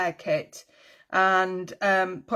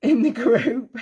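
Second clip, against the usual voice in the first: breaking into a real laugh, higher; 3 semitones higher, 4 dB louder than usual. Real, not acted. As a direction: laugh through the end of a joking apology. Laughs at own terrible accent.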